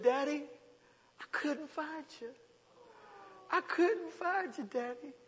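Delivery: put-on small, high, soft child voice; plaintive high voice of a tearful child; crying little boy. Lost son clinging to his daddy, small tearful voice. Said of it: "he just started crying".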